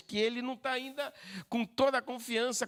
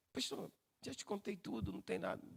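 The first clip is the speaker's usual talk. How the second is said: much softer, withdrawn voice; evasive denial; 12 dB quieter than usual. Acted. Church member telling the pastor nothing is left, hushed evasive denial. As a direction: shrink back and quietly insist. Counselled person evasively denies hiding anything.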